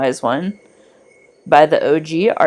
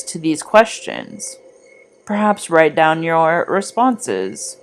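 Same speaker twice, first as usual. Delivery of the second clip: guided meditation. Acted. Guiding listeners back from the Wise One's clearing, calm meditation voice. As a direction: guide the listener calmly back along the forest path.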